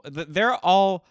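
normal talking voice

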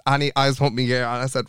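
ordinary talk, nothing marked

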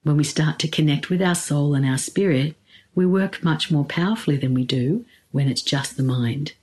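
ordinary talk

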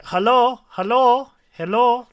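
high, loud imitation Indian accent. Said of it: "like, in his Indian accent".

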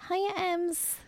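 bright sing-song hello; high cheery greeting. Real, not acted.

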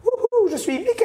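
squeaky falsetto Mickey whoop; very high cartoon mouse cry. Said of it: "Il me fait comme ça".

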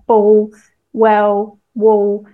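"ball, well, wall" with each final L turned into a w-like vowel. Shown as a demonstration. Linguist giving examples of her own speech, vocalised L in "ball, well, wall". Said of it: "kind of like a vowel, the way that I would say it".

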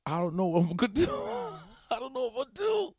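high, fast, laughing despair; high-pitched laughing panic; panicked laugh. Real, not acted. Panic over deadline, laughing.

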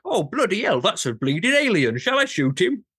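put-on outrageous English accent, loud and high; ridiculous English accent. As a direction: overdo a silly English accent, Cockney guard. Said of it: "the most ridiculous English accent ever".

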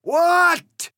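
very loud, very high shriek; outraged yell. Acted. The egg yells at Alice in outrage, very loud and high. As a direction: explode in outrage. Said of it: "he yelled in outrage".

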